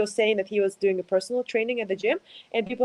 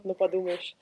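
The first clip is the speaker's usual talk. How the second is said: usual speaking voice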